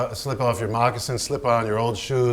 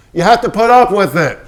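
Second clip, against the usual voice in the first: much higher, louder snap; angry order; 8 semitones higher, 10 dB louder than usual. Acted. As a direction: shout him down. Quarrel on set.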